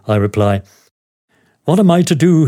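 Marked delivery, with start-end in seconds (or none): 1.6-2.4 high-pitched mild lament; crying out. A narrator's reading. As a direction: plead in an acted, raised-pitch cry. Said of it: "he cries".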